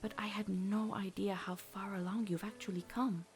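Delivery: mother quietly proud of her daughter; tender mother. Acted. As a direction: speak gently, full of pride.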